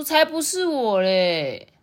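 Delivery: loud, drawn-out, high denial. A narrator's reading. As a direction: talk back to your sister, stretching the end. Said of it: "哥哥说". (哥哥拖长音顶嘴)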